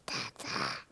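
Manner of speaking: raspy whispered little monster growl. Pretend. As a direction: play a tiny monster saying dada. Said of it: "Can you say dada like a monster?".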